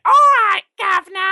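loud, very high mock Cockney screech; hammed-up Cockney impression of an annoying coworker; terrible Cockney accent. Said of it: "talks in this really terrible Cockney accent".

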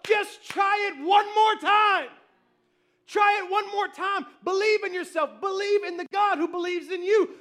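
loud, high rallying shout; impassioned coach-like preaching; urgent encouragement. Acted. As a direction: urge them on at full voice. Acts the urging encourager.

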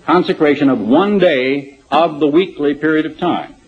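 "one" slowed and leaned on; stress on one. Shown as a demonstration. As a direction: hit "one" as the key word. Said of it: "Notice that".

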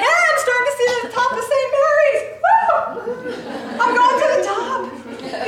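high, loud and giddy, laughing through it; breathless delighted shout of discovery; excited laughing whoop. Pretend. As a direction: cheer like you finally see the summit. Imagined climber nearing the top.

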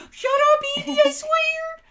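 imitating a little brother's squealed outburst; mock furious squeal; really high voice. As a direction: shriek it very high and loud. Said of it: "he had a really high voice".

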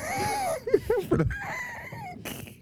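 shrieking high laugh. Real, not acted. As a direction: squeal with laughter. Bursts out laughing.

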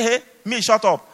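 high-pitched mock protest; imitated indignant retort. Blind Bartimaeus answering those silencing him, imitated indignant retort.

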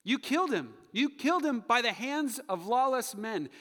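loud, high preaching indictment; accusing shout. Acted. Peter charges the crowd with killing, loud accusation. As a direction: drive the charge home forcefully.

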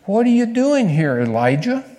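sharp high call, then dropping on the name; voice of God asking. Acted.

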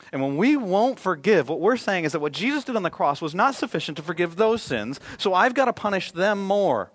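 loud, rising preacher's call; full-force preaching. Acted. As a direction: drive the point home to the congregation.

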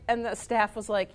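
ordinary talk, nothing marked

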